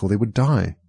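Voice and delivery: ordinary delivery, nothing marked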